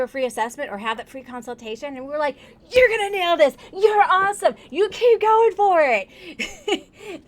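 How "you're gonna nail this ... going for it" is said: high, loud, laughing self-cheer; mock hype pep talk. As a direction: pump yourself up before the call. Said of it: "talk to ourselves that way".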